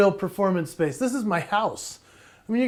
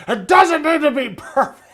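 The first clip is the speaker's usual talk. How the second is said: higher, louder hammed-up angry close-up, cracking into a laugh; over-the-top angry-guy impression; mock angry guy; 6 semitones higher, 5 dB louder than usual. Shown as a demonstration. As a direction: play a fake furious guy in close-up and break up laughing. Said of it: "close-up, like, you know, angry guy".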